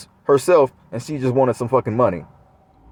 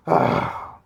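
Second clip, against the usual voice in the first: a breathy, voiceless imitated sigh of annoyance. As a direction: mimic her fed-up sigh, unvoiced. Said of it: "I don't know if it's a sigh or whatever".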